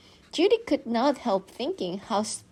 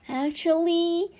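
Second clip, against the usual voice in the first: higher, slow sing-song Actually; drawn-out correction; 10 semitones higher than usual. Acted. Mom gently corrects Judy at dinner, drawn out. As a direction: stretch Actually before the correction.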